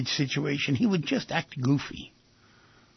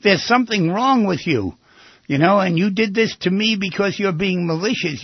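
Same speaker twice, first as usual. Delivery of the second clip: mock scream; 5 semitones higher, 5 dB louder than usual. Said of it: "if people scream at you and say".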